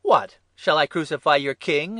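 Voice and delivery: loud, high, incredulous outburst; shocked exclamation. A narrator's reading. Pilate questioning the mob's demand, exclaiming. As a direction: exclaim in disbelief at the mob. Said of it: "exclaimed Pilate".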